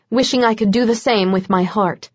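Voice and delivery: ordinary delivery, nothing marked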